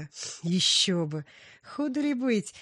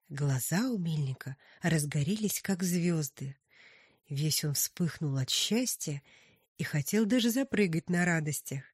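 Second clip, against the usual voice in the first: usual speaking voice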